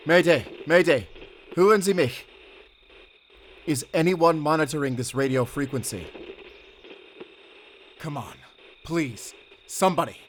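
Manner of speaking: loud, high calls for help into the radio; pleading mayday call over the radio; desperate radio call. Acted. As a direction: shout urgently, hoping anyone hears. Calling out on the radio.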